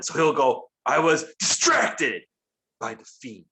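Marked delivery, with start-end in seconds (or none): 0.8-2.2 one word growing angry, drawn out slowly; exaggerated slow, angry wrestling-promo delivery; mock angry promo. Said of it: "says one word really, like, angrily". 2.8-3.4 suddenly soft, low and calm; mock-calm tail of a promo line; Randy Orton calm. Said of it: "says the rest of the sentence calmly".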